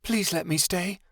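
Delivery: put-on high, pleading girl's voice; desperate plea. A narrator's reading. Chameleon begging Kyoto to stay, desperate. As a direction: beg him to let her stay. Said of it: "looked up at him desperately".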